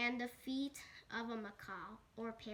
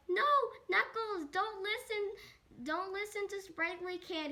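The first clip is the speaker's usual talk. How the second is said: mock pleading; 7 semitones higher, 5 dB louder than usual. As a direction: plead in a high cartoon voice. Sonic begs Knuckles not to listen.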